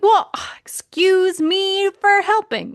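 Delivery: high, slow sarcastic huff; mock offended. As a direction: act out an indignant "excuse me".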